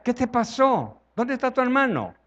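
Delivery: God asking Cain. Acted. Voz de Dios a Caín.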